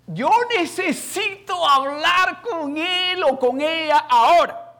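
very loud, high, drawn-out shout; furious demand. Retold or imitated. Father refusing the receptionist's objection, furious demand. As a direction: re-enact an outburst at full volume, slowly.

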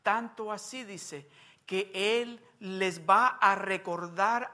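slow, intoned sermon cadence; drawn-out preaching. Acted. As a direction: stretch each phrase for the congregation. (predicación lenta y entonada)

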